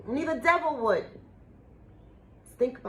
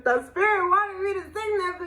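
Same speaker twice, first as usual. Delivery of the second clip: excited; 9 semitones higher, 5 dB louder than usual. Real, not acted. Excited after singing.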